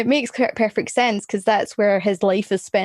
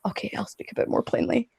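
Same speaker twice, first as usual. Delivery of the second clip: whispered; 9 dB quieter, faster than usual. Retold or imitated. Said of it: "where he's like".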